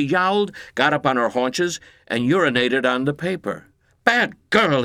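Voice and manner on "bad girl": higher, louder scolding shout. Acted. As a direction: shout at the cat to stop. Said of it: "he shouted".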